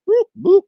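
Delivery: high, loud playful boop. Real, not acted. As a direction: pop it out like a buzzer. Playful sound effect.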